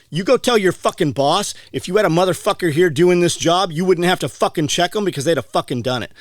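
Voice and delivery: worked-up rant with hard-hit swearing; retold angry rant. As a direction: replay chewing out a store clerk. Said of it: "You were so mad".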